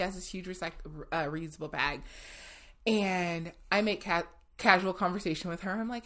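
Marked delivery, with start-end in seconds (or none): none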